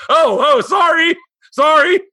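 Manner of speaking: high, loud panicked sorry; flustered apology. Retold or imitated. Dad caught out by his daughter, loud high flustered apology. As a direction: yelp the apology in embarrassment. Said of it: "And I went".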